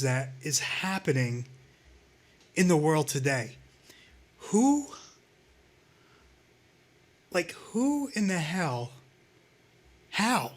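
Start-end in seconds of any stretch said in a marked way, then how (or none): 4.4-10.5 high, stunned disbelief; can't believe what he heard. Real, not acted. Stunned at Trump news.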